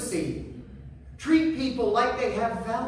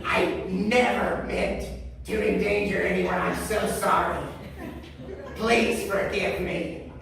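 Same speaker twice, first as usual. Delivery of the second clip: gravelly, awful voice; 5 semitones lower than usual. Retold or imitated. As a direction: drag out a troll-like, rasping apology. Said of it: "that gravelly, awful voice said".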